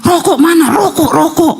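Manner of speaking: high, urgent mock cries; acted yelling. Possessed husband wakes demanding cigarettes, mock yelling. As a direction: demand it in a high-pitched yell. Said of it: "teriak-teriak".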